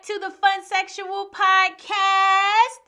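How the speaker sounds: big, slow, drawn-out host welcome; show intro. Acted. Host greeting her listeners, big slow announcer welcome. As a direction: sell the show open like a radio host.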